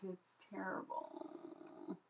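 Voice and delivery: slow whispered disgust; whispering. Real, not acted. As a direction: breathe it out slowly. Whispered groan.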